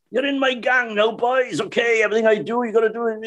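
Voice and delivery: put-on high, lively showman voice to children; rally the kids like a panto host; high playful panto host; [name] talking to kids. [name] addressing children on stage.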